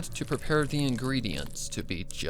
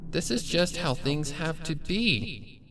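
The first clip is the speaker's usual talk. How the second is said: gloating villain pony; 5 semitones higher than usual. Acted. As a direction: croon it like a loving threat. Villain answers her prisoner.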